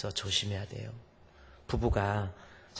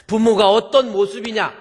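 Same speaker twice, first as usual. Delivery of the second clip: much louder and higher; emphatic question; 11 semitones higher, 16 dB louder than usual. Real, not acted. Preacher challenges the congregation, loud and high. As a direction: hammer the question home.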